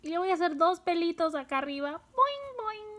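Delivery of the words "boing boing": very high springy boing; cartoon spring noise, softer on the second; boing sound effect. Shown as a demonstration. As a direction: make two tiny high-pitched boings. Imitates springy hairs while drawing.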